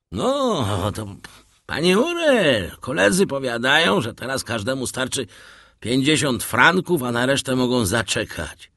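a plain-spoken seaman, a little awkward, speaking for his mates; old sailor speaking up. A narrator's reading.

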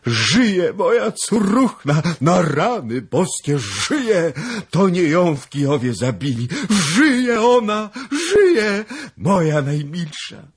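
loud, high, drawn-out cries of joy; frantic, overjoyed old man shouting; shouting like mad. Acted. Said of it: "począł krzyczeć jak szalony". (szaleńczy krzyk radości)

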